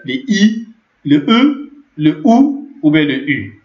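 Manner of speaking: I, E, OU, U lifted high and held; vowel demo. Shown as a demonstration. Teacher reminding learners of the vowels, high held vowel demo.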